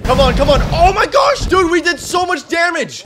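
high excited gamer yell; raging gamer. Real, not acted. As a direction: shout it at the screen in triumph. Fighting Ender Dragon, yelling.

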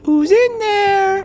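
loud, drawn-out falsetto call; high-pitched calling out. Pretend. Player calls into the dark, high drawn-out call.

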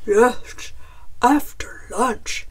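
loud, hearty bear voice; agreeing bear. A narrator's reading. Bear agrees to feed Chicken, hearty bear voice. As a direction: agree as a big friendly bear. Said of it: "Bear agreed".

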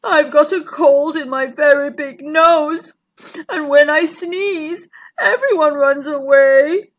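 doleful giant dinosaur with drawn-out words; plaintive, woeful creature voice; Gigantosaurus. A narrator's reading. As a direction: complain sadly as a lonely big creature. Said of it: "deep, sad voice".

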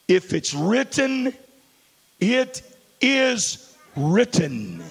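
each word drawn out and weighted; slow emphatic declaration. Acted. Preacher slowly pronouncing the written word to the church. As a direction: lay it down slowly like law.

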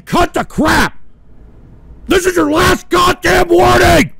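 loud, high furious warning; angry shout. Real, not acted. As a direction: bellow a final threat. Final warning to trolls.

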